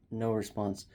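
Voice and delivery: normal talking voice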